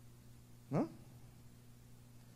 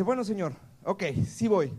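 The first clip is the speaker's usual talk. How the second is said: higher and louder, reluctant agreement; Moses giving in; 5 semitones higher, 6 dB louder than usual. Acted. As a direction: Moses caving in to God. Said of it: "le dice". (Moisés cediendo, más agudo y fuerte)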